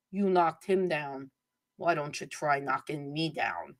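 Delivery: slow, low quoted movie challenge; soft, drawn-out mock challenge; quiet film challenge. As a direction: lightly act a menacing line, low and slow. Quoting a boxer's challenge from film.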